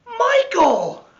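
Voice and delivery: high, loud shouted cry; whole class blurts the name; class shouting. Acted. The class shouts Michael's name, high and loud.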